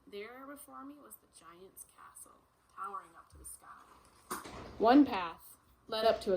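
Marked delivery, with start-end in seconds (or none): 0.1-4.5 suspenseful whisper. Acted. Suspense in story.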